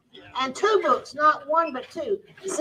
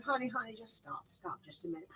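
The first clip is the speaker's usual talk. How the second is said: hushed soothing; 17 dB quieter than usual. Retold or imitated. Calming laughing helper.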